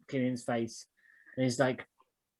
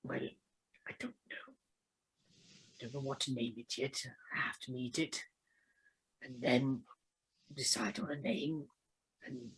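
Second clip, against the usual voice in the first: hushed, halting gratitude; softly sincere Charlie; 5 dB quieter than usual. Acted. Charlie confides in Killian about the egg, soft and sincere. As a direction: trail almost into a whisper, tentative.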